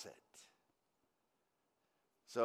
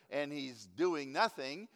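ordinary delivery

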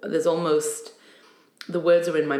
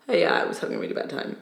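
low casual offhand voice; 13 semitones lower, faster than usual. Retold or imitated. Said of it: "be like".

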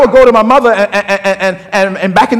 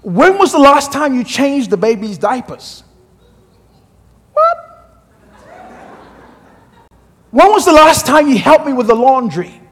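wife's complaint; 3 semitones higher than usual. Retold or imitated. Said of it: "and she said".

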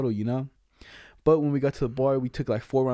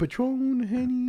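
mock high girly voice; 10 semitones higher, much slower than usual. Mimicking girl ordering.

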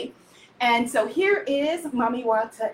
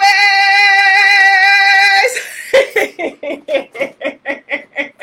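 loud, high laughter; 7 semitones higher, 8 dB louder than usual. Real, not acted. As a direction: let the laugh ring out. Laughing after greeting viewers.

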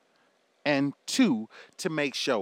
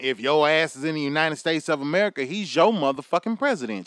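usual speaking voice

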